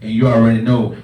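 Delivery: ordinary delivery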